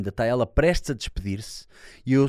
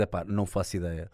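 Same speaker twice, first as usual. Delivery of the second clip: ordinary talk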